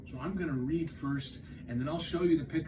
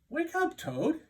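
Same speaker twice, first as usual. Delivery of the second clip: gentle wake up; 13 semitones higher than usual. Acted. Frog waking Toad.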